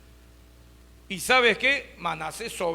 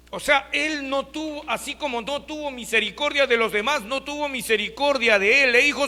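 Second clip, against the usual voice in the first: ordinary talk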